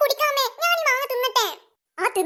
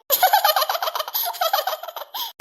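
high teasing giggle; cheeky giggle; 5 semitones higher than usual. Acted. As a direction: giggle at her sister's trouble. Girl laughs at mother's remark. (കളിയാക്കി ചിരിക്കുന്നു)

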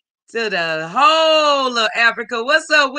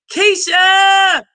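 joyful call; 9 semitones higher, 5 dB louder than usual. Real, not acted. Greeting a viewer joyfully.

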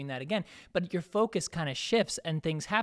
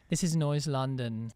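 slow, drawn-out mock channel intro; impression of co-host; slower than usual. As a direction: lightly mimic a co-host's slow sign-on. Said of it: "You say".

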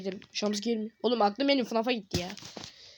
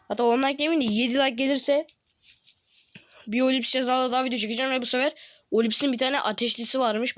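ordinary talk

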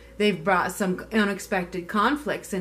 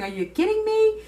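much higher, loud, indignant; mock outrage, pitched way up; outraged disbelief; 13 semitones higher, 5 dB louder than usual. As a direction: play the offended one who won't be messed with. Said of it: "That's what I keep hearing".

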